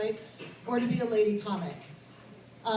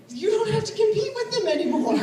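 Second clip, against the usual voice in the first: punchline delivered higher and louder; punchline push; 8 semitones higher, 6 dB louder than usual. Real, not acted. Comedian to audience, gleeful punchline push. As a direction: land the joke big.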